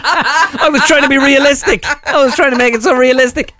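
high-pitched protest through laughter; laughing protest. Real, not acted. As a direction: defend yourself while cracking up. Protesting amid laughter after being teased.

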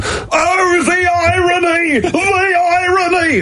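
very high, slow wailing lament of a commentator; tearful commentator. Retold or imitated. Imitating a commentator almost in tears over the match. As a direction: wail it like a heartbroken TV commentator. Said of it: "the commentator going, he was almost in tears".

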